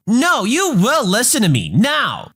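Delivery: high, slow, mock-commanding; a hammed-up entitled customer ordering; demanding Karen. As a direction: caller insisting the rep keep listening, high-pitched. Karen refuses to let him hang up.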